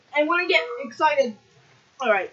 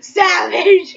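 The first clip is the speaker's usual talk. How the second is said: louder, higher teasing call; 4 semitones higher, 9 dB louder than usual. Real, not acted. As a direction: yell it out to tease. Teasing friend about channel.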